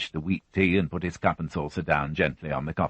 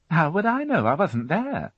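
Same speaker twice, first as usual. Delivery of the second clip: lightly raised fussy housewife voice; a higher, brisk widow answering the policeman; Mary Rothwell voiced; 13 semitones higher, 3 dB louder than usual. A narrator's reading. Widow answering the inspector, a higher fussy voice.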